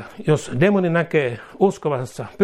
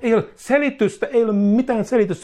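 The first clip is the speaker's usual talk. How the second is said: high, forceful preacher's cry; fiery preaching; 9 semitones higher than usual. Real, not acted. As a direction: proclaim it with full force.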